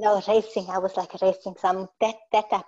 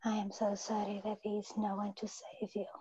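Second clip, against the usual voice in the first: soft whispered repeat; 13 dB quieter than usual. Real, not acted. Client repeating the healer's words in a whisper.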